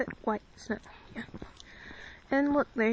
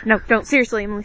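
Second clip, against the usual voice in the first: loud warning; 11 dB louder, much faster than usual. Real, not acted. Warning sister off camera.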